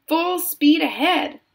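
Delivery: high, slow, ringing command; shout. A narrator's reading. Wonka waves his stick at the rowers, shouting. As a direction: stretch out the rallying cry. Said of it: "shouted Mister Wonka".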